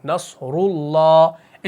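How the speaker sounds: नसरुल्लाह slowed, रु thick; heavy ru. Shown as a demonstration. Teacher showing heavy ru, slowed. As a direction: say नसरुल्लाह with a full mouth. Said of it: "मुंह को भारी करना है".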